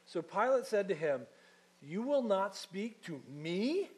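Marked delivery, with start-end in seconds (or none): 1.8-3.9 slow, higher, indignant disbelief. Acted. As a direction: drag it out, offended and incredulous. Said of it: "Can you hear the indignation?".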